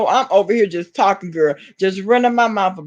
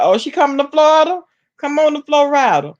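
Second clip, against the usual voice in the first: excited dare; 6 semitones higher, 3 dB louder than usual. Real, not acted. Daring a woman to come.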